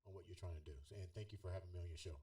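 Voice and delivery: normal talking voice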